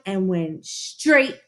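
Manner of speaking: plain reading voice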